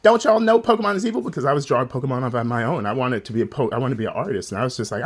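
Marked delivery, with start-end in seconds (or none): 0.0-1.2 high, fast, indignant church lady; mock the lady from church. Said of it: "She's just like".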